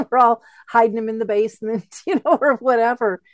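laughing it off as she talks; light laugh running through a loud quip. Real, not acted. Laughing about hiding emotions.

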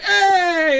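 high celebratory whoop; mock cheer for a kid's big win; triumphant cheer. Dad celebrating with son in the bath, imitated high cheer.

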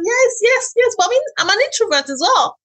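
high, fast eager reply; bubbly insistence that she's introverted; animated reply. Retold or imitated. Telling friends she's an introvert, high and eager.

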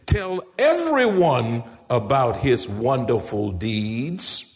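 slow, drawn-out reading of scripture. Acted. Preacher reading the psalm to the congregation, slow and solemn. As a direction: lift each line of the verse up slowly. Reads scripture slowly, dramatically.

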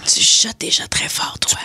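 slow, audible stage whisper. Shown as a demonstration. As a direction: whisper it slowly. Whispered remark on loud whispering.

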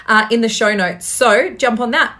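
ordinary talk, nothing marked

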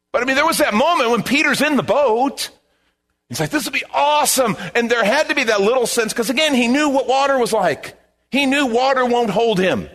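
high, loud preaching build-up; full-force preaching. Real, not acted. Pastor preaching to the congregation, high and loud. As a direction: preach it out, lifting the whole room.

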